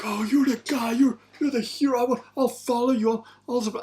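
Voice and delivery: high, loud starstruck gushing; mocking adoring trainee. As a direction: gush like a hero-worshipping fan, way up high. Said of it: "used to come to me at training and be like".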